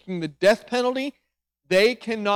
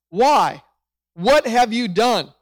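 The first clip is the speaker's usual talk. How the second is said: demanding question; 3 semitones higher, 6 dB louder, slower than usual. Acted. Pilate interrogating Jesus.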